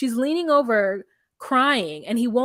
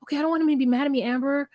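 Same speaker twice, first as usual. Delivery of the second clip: higher, softer, nervous mock voice; imitating Puppy; 4 semitones higher, 3 dB quieter, faster than usual. Friend voicing Puppy, scared to enter the house, timid. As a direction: play her timid and unsure. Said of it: "Puppy's like".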